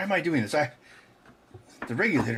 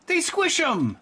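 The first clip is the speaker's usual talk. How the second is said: much higher playful menace; mock-cute threat; slower than usual. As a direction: threaten the kitten in a squeaky voice.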